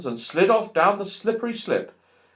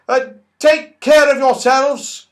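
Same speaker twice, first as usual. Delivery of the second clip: slow, high, loud call; 12 semitones higher, 9 dB louder than usual. Acted. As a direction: call after them kindly. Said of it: "called What's-His-Name".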